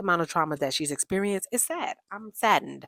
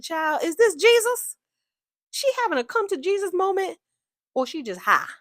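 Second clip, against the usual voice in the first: mock incredulity; 11 semitones higher, 4 dB louder than usual. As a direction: ask it like an astonished onlooker. Acts out her own baffled question.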